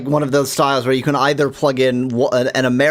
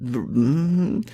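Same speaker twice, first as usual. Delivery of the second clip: ordinary talk, nothing marked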